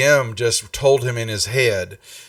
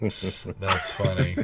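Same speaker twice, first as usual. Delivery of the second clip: laughing; 4 semitones lower, 8 dB quieter, much slower than usual. Real, not acted. Laughing at the joke.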